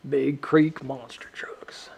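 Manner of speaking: slow whispered Big Creek monster trucks; slow savouring whisper. Shown as a demonstration. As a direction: whisper it like something delicious. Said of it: "Just love the way that sounds".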